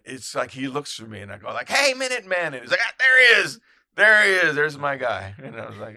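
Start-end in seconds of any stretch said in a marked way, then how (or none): 1.7-2.5 loud, high shouted greeting; hearty raised-voice hail; calling out. Retold or imitated. Calling out to a friend. 2.8-5.3 loud, high hail to a buddy; acted exuberant greeting; delighted shout.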